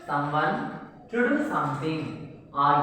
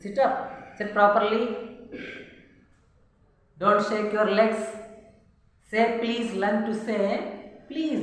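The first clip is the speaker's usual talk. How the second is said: scolding parent; 4 semitones higher than usual. Acted. Voicing parents' orders.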